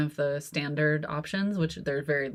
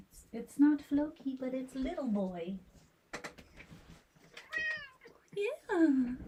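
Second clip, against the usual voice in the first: pet voice; 7 semitones higher than usual. Real, not acted. Owner greeting her kitten, in high baby talk. Talking sweetly to her cats.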